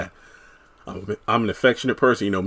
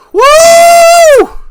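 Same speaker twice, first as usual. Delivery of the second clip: excited whoop; 13 dB louder than usual. Real, not acted. Excited at seeing the neighbor.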